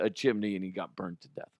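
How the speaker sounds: ordinary talk, nothing marked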